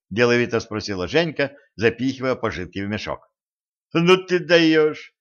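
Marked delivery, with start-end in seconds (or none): 3.9-5.1 loud, slow, higher delight. A narrator's reading. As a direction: marvel at her, drawing it out. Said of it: "восхитился Кощей". (восхищённо)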